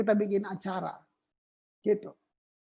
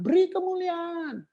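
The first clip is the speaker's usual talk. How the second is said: higher mock prompt to give glory; mock worship leader call; 7 semitones higher than usual. Preacher mimicking a leader cueing applause, higher mock voice. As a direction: parody the leader's cue for applause. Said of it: "terus kamu bilang".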